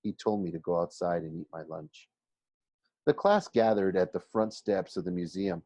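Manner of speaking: ordinary delivery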